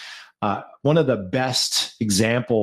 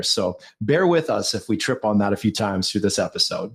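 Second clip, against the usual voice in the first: normal talking voice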